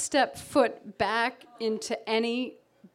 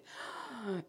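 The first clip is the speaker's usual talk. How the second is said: sharp gasp; 13 dB quieter than usual. Retold or imitated. Spotting the branch.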